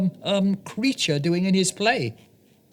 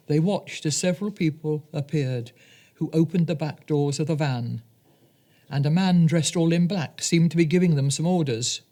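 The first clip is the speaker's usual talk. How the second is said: ordinary delivery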